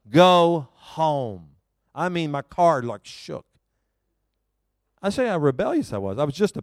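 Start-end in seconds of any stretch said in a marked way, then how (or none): 0.1-1.5 God's voice repeating it louder; a firm, louder order to go home; louder command. Retold or imitated. God repeats his order more firmly, louder command. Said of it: "he said it again louder".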